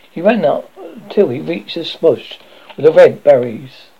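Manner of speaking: usual speaking voice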